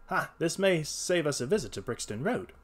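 ordinary delivery

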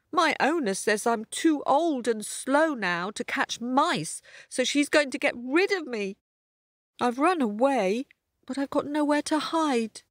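self-pitying storybook cat; sorry old cat. Acted. Voices the old tabby cat.

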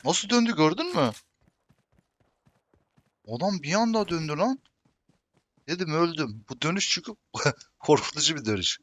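loud, high, startled yelling after a scare; excited shouting. Real, not acted. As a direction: shout it out, heart still racing. Sudden close-call firefight, startled.

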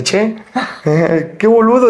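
a hint of Argentine accent with a laugh. Argentine boss teasing him, lightly Argentine. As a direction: toss off "che" like a porteño. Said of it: "es argentino".

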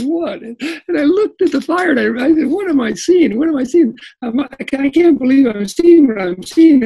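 can't believe his eyes; astonished. Retold or imitated. Camper startled by sheep at his campfire, astonished.

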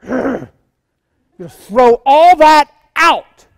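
slow, loud and high, thrown out to the church; preaching shout. Real, not acted. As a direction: shout it out slowly, like throwing it all away.

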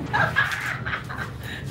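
high-pitched giggle; amused, high laughter. Real, not acted. Laughs at the lights mishap.

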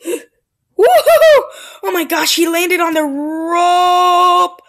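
high, loud cry of delight; thrilled shout. Real, not acted. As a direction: cry out in disbelief. Thrilled by the landing.

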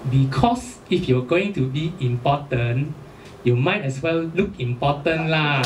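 slow, low Singlish punchline; Singlish Ah Beng. Retold or imitated. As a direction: deliver it as a deliberate Ah Beng. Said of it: "The Abeng said".

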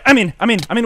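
quick burst of excitement; excited call-out. Real, not acted. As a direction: call out your breakthrough mid-fight. Calling out a successful pearl.

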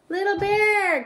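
high, loud call across the stream. Acted. Big Bear calls Little Bear across the stream, loud high call. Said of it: "called Big Bear".

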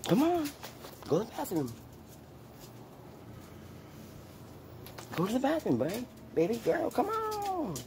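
very high sing-song coaxing; high coaxing pet voice. Real, not acted. Urging the dog Lulu to go out, high baby talk.

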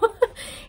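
a quick airy giggle; light unvoiced giggle; high breathless laugh. Real, not acted. Laughing at own emotion.

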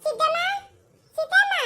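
put-on high, rising child call. Little girl calls Siddamma, rising high call. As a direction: shout her name across the yard.

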